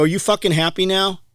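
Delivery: exasperated mock confrontation; retold angry jab. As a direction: throw it back at the clerk, replaying the anger. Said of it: "You were so mad".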